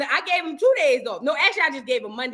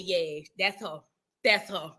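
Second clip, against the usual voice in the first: resigned; 7 semitones lower, 6 dB quieter than usual. Retold or imitated. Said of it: "I said".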